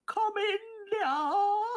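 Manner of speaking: put-on very high, quavering old lady. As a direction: put on a frail granny voice to lure them in. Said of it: "the imp imitating an old lady".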